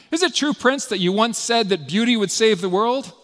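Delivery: high, loud mocking challenge to a prince. A narrator's reading. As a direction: taunt him with a mock-polite question. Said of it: "mockingly says to Prince Mishkin".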